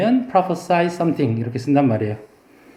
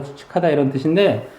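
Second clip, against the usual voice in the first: ordinary talk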